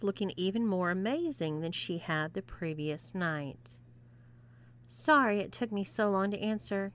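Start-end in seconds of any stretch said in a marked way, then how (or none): none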